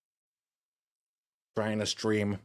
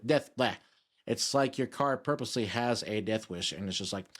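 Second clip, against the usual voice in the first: ordinary delivery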